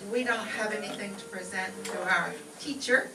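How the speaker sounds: ordinary talk, nothing marked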